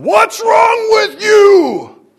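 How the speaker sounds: high furious shout from above; angry God yelling. Pretend. Preacher voicing a harsh God scolding the tempted, furious shout. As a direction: bellow it down like a fed-up god. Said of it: "God up there going".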